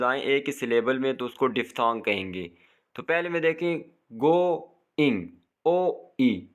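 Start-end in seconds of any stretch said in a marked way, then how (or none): 4.1-6.5 गोइंग split into go-ing, then ओ ई apart; two vowel sounds. Shown as a demonstration. Teacher breaking a diphthong apart for students, two separate sounds. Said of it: "दो sounds आ रही हैं ओ और ई की".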